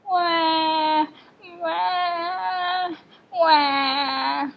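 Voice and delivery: long, held mock wails; drawn-out "wah" cries in imitation of a baby; imitated baby cry. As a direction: wail like a crying baby, hammed-up.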